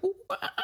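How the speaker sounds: high monkey hoots; monkey sounds. Shown as a demonstration. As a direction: hoot like a monkey. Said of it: "I'm really good at doing monkey sound effects".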